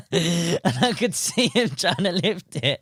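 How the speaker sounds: a laugh breaking up the words as he tells it. Real, not acted. Laughs while telling the catch.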